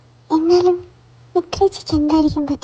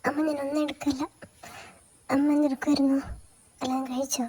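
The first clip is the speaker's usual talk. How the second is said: hushed, close to tears, softer; choked quiet voice; 9 dB quieter than usual. Acted. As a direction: hide the tears behind a near whisper. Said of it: "നിന്റെ sound എന്താ വല്ലാണ്ടിരിക്കുന്നേ?". (കരച്ചിലടക്കിയ പതിഞ്ഞ ശബ്ദം)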